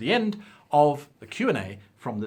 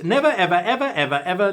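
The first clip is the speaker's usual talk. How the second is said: emphatic; 6 semitones higher, 6 dB louder than usual. Retold or imitated. Reading viewer's emphatic advice.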